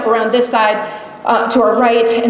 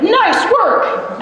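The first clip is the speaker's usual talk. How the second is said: excited praise; 10 semitones higher than usual. Retold or imitated. Guide praising her after the shots.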